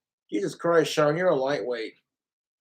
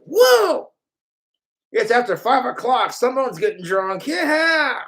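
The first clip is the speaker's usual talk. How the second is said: put-on loud, high drunken-party cheer; rowdy party whoop; 6 semitones higher, 6 dB louder than usual. Someone cheers after five o'clock drinking, rowdy high shouting. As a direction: whoop it up, ending on a yell.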